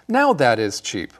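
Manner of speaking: "now" stressed, "that" left flat; teacher showing the wrong stress on "now". Shown as a demonstration. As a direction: put the weight on "now" as the wrong example. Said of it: "We don't say".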